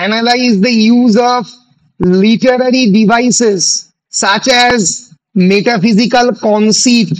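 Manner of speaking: measured, drawn-out words for note-takers; slow dictation. Real, not acted. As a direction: say it slowly, phrase by phrase. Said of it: "আস্তে আস্তেই বলছি".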